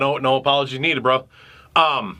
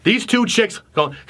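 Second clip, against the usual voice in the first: ordinary talk, nothing marked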